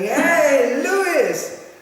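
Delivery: high, loud call across the parking lot; excited yelled greeting. Retold or imitated. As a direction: shout his name from far off with joy. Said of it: "he was going".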